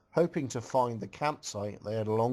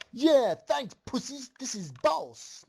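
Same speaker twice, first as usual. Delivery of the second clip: yelled; 11 semitones higher, slower than usual. A narrator's reading. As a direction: crow it out at the top of the voice. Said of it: "yelled out".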